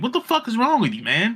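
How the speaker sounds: a light fake blow-up; mock angry outburst. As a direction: imitate losing patience with a stalker fan. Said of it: "been like".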